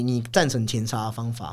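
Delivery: ordinary talk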